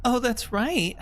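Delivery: very high-pitched character voice; Mash. Acted. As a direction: keep it high all through. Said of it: "I don't think I can do Mash's voice".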